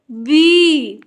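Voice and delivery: बी with a long, held ee. Shown as a demonstration. Teacher sounding out B double E, drawn-out बी. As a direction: stretch बी out firmly. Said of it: "थोड़ा लंबा होगा".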